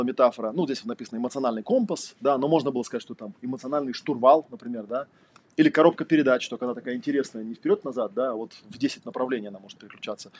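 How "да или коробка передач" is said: ordinary delivery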